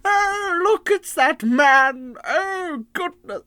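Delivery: very high, loud exclamations, hammed-up; mock Duke of Manhattan. Mocking the Duke's wildly fluctuating accent, high, loud exaggeration. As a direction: swoop up high and draw it out. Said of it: "he sort of fluctuates quite wildly".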